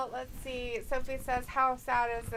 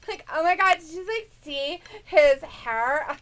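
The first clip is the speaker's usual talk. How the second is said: put-on Valley girl; 3 semitones higher, 11 dB louder than usual. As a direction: ham it up as an appalled Valley girl. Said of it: "talks like a Valley girl".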